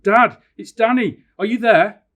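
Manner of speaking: loud, high, drawn-out calling; shouting for Dad. Acted. Danny calling to his lost father in the wood, shouting. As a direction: holler slowly so it carries through trees. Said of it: "I shouted".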